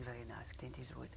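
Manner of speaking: soft dreamy whisper; whispered name. Real, not acted. Actress whispering the star's name while remembering him. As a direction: breathe the name like a fond secret. Fond memory, trailing whisper.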